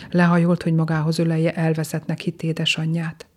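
usual speaking voice

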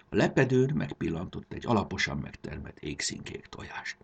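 usual speaking voice

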